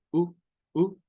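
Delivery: a short clipped u vowel; short U sound. Shown as a demonstration. Teacher showing the short u vowel. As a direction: cut the u short. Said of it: "el sonido de la U".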